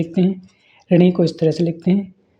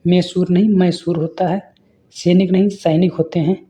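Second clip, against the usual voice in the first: ordinary talk, nothing marked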